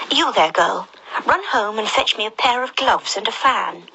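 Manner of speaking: a hurried rabbit calling orders. Acted. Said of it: "The rabbit called out to her".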